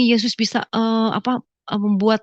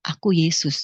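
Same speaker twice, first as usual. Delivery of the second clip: hushed, lowered divine voice; Jesus; 5 semitones lower than usual. Retold or imitated.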